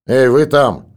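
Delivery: loud call from behind a door; voice through the door. A narrator's reading. Annoyed neighbour calls through the cabin door, loud. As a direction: shout from the next cabin, muffled. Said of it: "раздался голос из-за двери".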